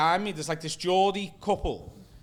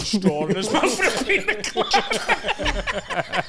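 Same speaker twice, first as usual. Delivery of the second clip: helpless laughter breaking into the story; laughing while talking; 8 semitones higher, 5 dB louder, slower than usual. Real, not acted. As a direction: get the punchline out through real laughter, high. Cracking up while telling the punchline.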